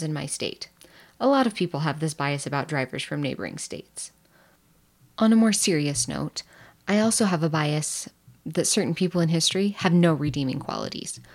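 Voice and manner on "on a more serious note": ordinary talk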